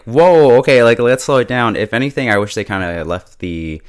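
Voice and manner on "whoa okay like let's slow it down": whoa bursts out much louder and higher; overwhelmed whoa. Retold or imitated. Viewer overwhelmed by the show's rush, a loud high whoa. Reacting to rushed plot reveals.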